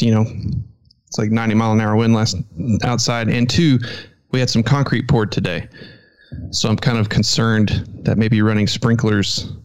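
ordinary talk, nothing marked

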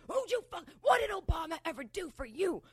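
high, loud angry yelling; retold angry shout. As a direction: yell at her like a bully. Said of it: "So he's screaming at her".

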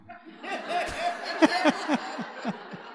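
airy, high-pitched silent laugh; breathy high laugh. Real, not acted. Speaker laughing at his own foolishness, airy laugh.

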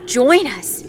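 loud, rising-then-falling phantom plea; begging voices. A narrator's reading. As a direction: beg them loudly to join. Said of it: "Over and over they begged".

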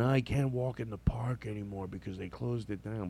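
a hint of a soft, quiet old man; lightly voiced old man grumbling quietly; murmured old-man lament; old barber's complaint.

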